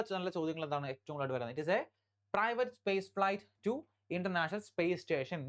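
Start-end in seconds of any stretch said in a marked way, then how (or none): 1.6-5.4 very slow, word by word headline read; slow dictation. Shown as a demonstration. Teacher dictating headline to exam students, very slow. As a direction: dictate it slowly so students can write. Reads headline slowly for notes.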